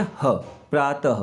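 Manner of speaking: प्रातः slowly with a breathy ह at the end; slow प्रातः with a final ह breath; visarga demo. Shown as a demonstration. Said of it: "इसकी जो ध्वनि निकलती है".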